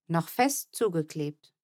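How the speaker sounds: ordinary delivery, nothing marked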